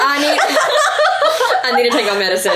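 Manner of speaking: high, loud laughing, near tears; laughing through tears. Real, not acted. Friend laughing and teary after a moving affirmation, high and laughing. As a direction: laugh it off while welling up. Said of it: "I'm about to cry".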